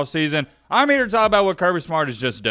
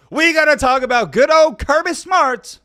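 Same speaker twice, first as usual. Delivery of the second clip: hyped intro; 11 semitones higher, 7 dB louder than usual. Real, not acted. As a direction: sell the main topic like a big reveal. Hyping the main topic of video.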